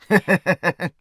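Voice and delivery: short mocking chuckle in quick pulses; staccato villain's laugh; gloating laugh. Merchant gloats after insult. (得意的嘲笑)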